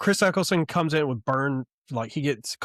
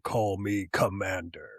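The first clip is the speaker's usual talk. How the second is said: lower, slow villain voice with a changed timbre; villain reveal voice; 6 semitones lower, 6 dB quieter than usual. Retold or imitated. Retelling the Cobra Commander reveal again, lower slow villain voice. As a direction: announce your new identity slowly and low. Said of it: "his voice completely changes".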